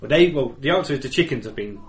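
usual speaking voice

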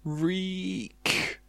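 high drawn-out screech, kept soft; soft, high-pitched mock yell; mock car yell. A narrator's reading. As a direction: imitate screeching tyres without shouting. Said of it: "yelled the car".

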